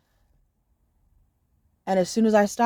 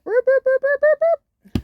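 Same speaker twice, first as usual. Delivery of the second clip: high beeping boops; cartoon rewind sound effect; rewind noise; 6 dB louder, slower than usual. Shown as a demonstration. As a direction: imitate a tape rewinding, high and quick. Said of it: "rewind this a little bit".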